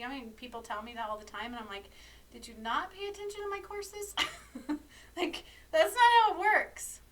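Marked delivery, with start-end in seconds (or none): 2.3-7.0 high-pitched incredulous retort breaking into a laugh; exasperated mock disbelief. Acted exasperated retort to clients.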